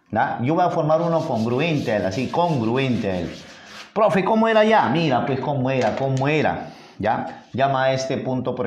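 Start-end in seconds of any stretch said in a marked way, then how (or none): 3.9-4.9 lightly voiced pupil, higher and louder; a hint of a puzzled kid asking the teacher; teacher playing a pupil who forgot the step; pupil's voice.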